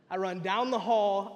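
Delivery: ordinary delivery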